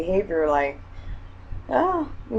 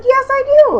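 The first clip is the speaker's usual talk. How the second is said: much higher and louder, fighting back; heated insistence; 10 dB louder than usual. Retold or imitated. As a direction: she yells back at her inner voice. Arguing back with inner voice.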